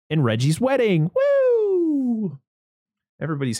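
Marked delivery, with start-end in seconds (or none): 1.1-2.4 a sudden high, joyful woo; excited whoop. Acted. Host cheering the wedding reveal with an excited whoop.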